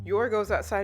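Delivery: ordinary delivery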